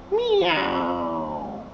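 long, low, soft meow; low cat meow. Pretend. Cat answering Teacher Scott, long low meow. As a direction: draw out a deep imitated meow.

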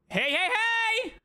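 high, drawn-out hey yells; shouted heys. Real, not acted. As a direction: yell the heys slowly and high. Shouting to move game character. (heys gritados y alargados)

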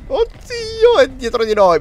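scared high yelp; panicked as it closes in; being chased. Real, not acted. Beast right behind them.

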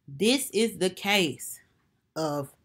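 low, slow, put-on deep voice; slow deep mock-trial opener; deep dramatic case announcement. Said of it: "I gotta put my deep voice on".

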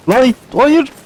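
high-pitched panicked cry of no; real alarm, voice jumping up high; alarmed outburst. Real, not acted. Player caught by danger in the game, panicked.

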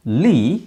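first syllable "ಲೀ" drawn out alone; isolated syllable. Shown as a demonstration. Teacher breaking down listen for learners, isolated syllable. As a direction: hold "ಲೀ" as a long lee sound.

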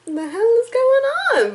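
confused outburst. Retold or imitated. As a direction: act out her own confusion. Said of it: "I was like".

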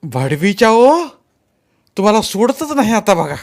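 high, loud cursing; angry threat. A narrator's reading. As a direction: shout a threat after them. Boy threatens fleeing boys.

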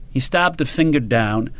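usual speaking voice

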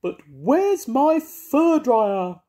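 a diva cat wailing over her lost dryer. Acted. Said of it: "wailed Moggy".

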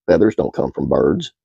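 ordinary delivery

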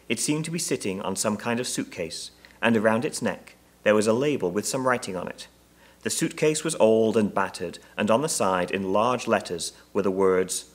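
ordinary delivery

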